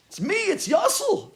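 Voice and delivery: high, loud, drawn-out plea; calling out. Retold or imitated. Son to father who doesn't recognize him, loud high plea. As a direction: insist urgently on being recognized.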